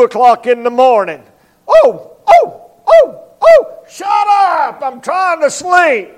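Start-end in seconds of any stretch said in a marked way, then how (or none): 1.7-3.7 high barking yelps, dog imitation. Guard dog barking at a thief at two in the morning, high yelps. 3.9-6.1 higher, half-shouted shut up; sleepy owner yelling. Retold or imitated. Woken owner yells at the barking dog, half-shouted.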